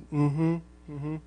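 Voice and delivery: soft imitated mm-hmm; meek agreement. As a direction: barely voice your agreement to the boss. Meekly answering the angry field owner.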